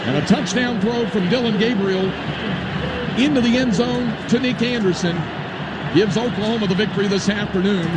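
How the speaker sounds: rising, building radio football call; excited play-by-play call. Real, not acted. Live play-by-play climax.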